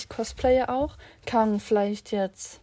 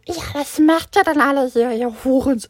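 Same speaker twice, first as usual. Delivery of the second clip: louder, higher rude scolding; rude teacher; 3 semitones higher, 7 dB louder than usual. Retold or imitated. Teacher rudely asks the students why they are there, loud mock scolding. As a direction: snap at the kids like a rude teacher. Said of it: "und die meinte so".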